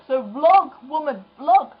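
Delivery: loud playful demand; urging shout. Real, not acted. To her friend Esther, a loud playful call to vlog. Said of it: "I'm waiting for her to vlog".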